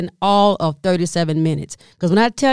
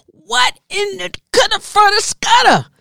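high, sputtering nonsense words; 1 exasperated gibberish; 11 semitones higher, 3 dB louder than usual. Acted. Comedian sputtering at hopeless hotel staff. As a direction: sputter as if words fail you.

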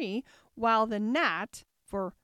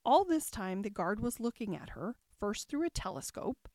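usual speaking voice

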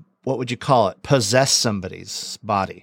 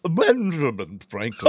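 Franklin voice try; 2 semitones higher than usual. Acted. Co-host tries Franklin voice.

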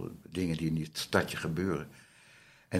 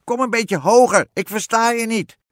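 very high, very loud witch yell; piercing storybook witch shout; 13 dB louder than usual. A narrator's reading. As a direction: holler down like a witch who can't hear. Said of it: "schreeuwde de heks".

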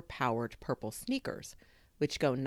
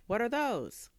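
high-pitched curious question; puzzled neighbour; 10 semitones higher than usual. A narrator's reading. Neighbour asking about a new word, high and puzzled. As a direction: ask it high, wanting to know.

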